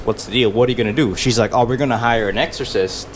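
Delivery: ordinary delivery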